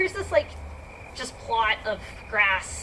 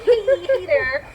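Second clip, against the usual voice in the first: mock greeting; 5 semitones higher, 7 dB louder, much slower than usual. Greeting dog in stall.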